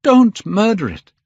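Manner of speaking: loud, high horrified cry. A narrator's reading. Dorian defending his portrait, desperate cry. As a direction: shout it urgently, pitch right up. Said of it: "he cried".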